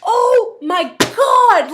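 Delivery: drawn-out, loud, high Oh my God; a sister remembering the silent treatment; real disbelief. Real, not acted. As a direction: stretch it out in shock. Exclaiming at memory.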